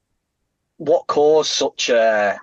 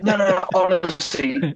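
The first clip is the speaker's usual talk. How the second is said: polite refusal; 5 semitones higher than usual. Retold or imitated. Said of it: "I was like".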